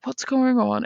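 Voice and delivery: mock bewildered alarm. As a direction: sound lost and a bit alarmed. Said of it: "And I was like".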